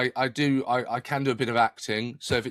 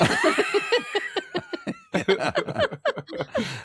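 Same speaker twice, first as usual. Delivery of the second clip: long high laugh; high laughter. Real, not acted. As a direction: laugh hard. Joke about any money.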